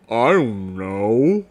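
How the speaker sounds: low, glum donkey voice; gloomy Eeyore. Acted. Eeyore greets his visitors, low and gloomy. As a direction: drag it out like sad Eeyore.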